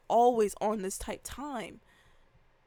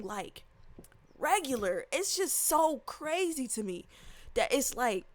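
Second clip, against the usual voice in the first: ordinary delivery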